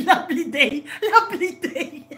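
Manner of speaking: cracking up, pitch climbing; laughing. Real, not acted. Streamer cracking up at a song used as a pickup line, laughing. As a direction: talk through rising laughter.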